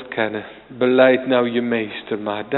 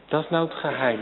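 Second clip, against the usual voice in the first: normal talking voice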